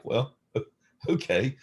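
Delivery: caught-off-guard laughing okay; giving in with a chuckle; laughing agreement. Retold or imitated. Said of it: "And I'm like".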